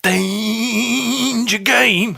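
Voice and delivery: high, wavering, very slow warning; drawn-out catchphrase. Pretend. Answering the co-host's game, very drawn-out warning.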